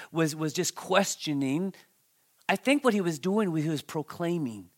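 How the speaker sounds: usual speaking voice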